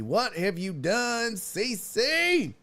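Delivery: high, slow mock-horrified reproach; hammed-up rising lament; theatrical dismay. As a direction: draw it out, climbing to the name. Repeats reproach, building theatrically.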